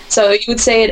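ordinary talk

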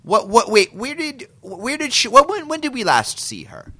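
high, startled rush of questions; surprised. Real, not acted. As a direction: jump in, caught off guard. Sudden confused interruption.

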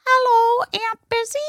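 put-on high, sweet little kid voice on the phone; little boy voice. Nephew calls his aunt, in a high little-kid voice. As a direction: pitch it up like a tiny five-year-old. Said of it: "I hear this voice go".

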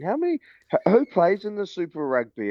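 high mock clueless voice; mimicking the Aussie pro. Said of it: "I had my pro here and is watching".

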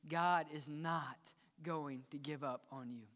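ordinary talk, nothing marked

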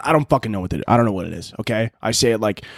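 normal talking voice

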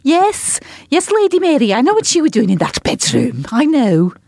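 high-pitched, hammed-up Scouse accent, sly and knowing; mock Scouse accent. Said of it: "she had a right good Scouse accent".

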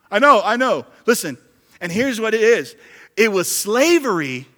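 high, loud full-force sermon; fiery preaching. Real, not acted. Pastor thundering at his church family, full-force preaching. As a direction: preach it hard to the congregation.

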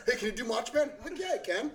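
mock dialogue. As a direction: act out both sides mockingly, half laughing. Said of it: "The same voice off for everybody".

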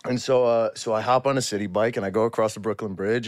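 ordinary talk, nothing marked

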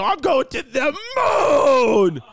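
high, drawn-out triumphant yell; hyped-up shout. Acted. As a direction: holler it like a launch, stretching the last word. Gleeful crypto boast, called out.

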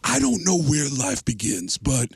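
slow ominous O J voice; a sinister O J punchline; O J Simpson. Retold or imitated. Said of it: "He'll go".

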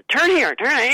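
a hammed-up shout of turn here; imitating a yell. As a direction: bark out the directions like a bossy passenger. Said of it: "when they start yelling".

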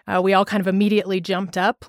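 ordinary delivery

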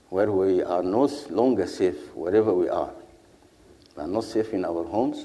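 usual speaking voice

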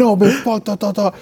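high-pitched mock arguing; agitated protest. Retelling a fighter talking back, mildly agitated. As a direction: imitate a fighter protesting, trailing into nonsense.

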